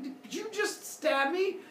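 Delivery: high, slow disbelieving question; high incredulous dad; stunned. Retold or imitated. Father Sky stunned.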